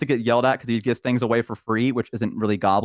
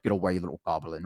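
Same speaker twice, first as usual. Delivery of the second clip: mean orc; 6 semitones lower, 9 dB quieter than usual. Retold or imitated. Mean orcs shooing the goblin.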